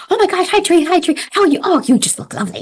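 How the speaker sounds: high, excited gushing hello; gushing coworker greeting. Retold or imitated. Coworker greeting the narrator with high gushing excitement. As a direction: gush over her like an old friend. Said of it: "she'd be like".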